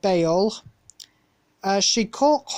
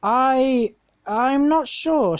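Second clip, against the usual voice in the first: stuttered; 6 semitones higher, slower than usual. A narrator's reading. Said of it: "stuttered James".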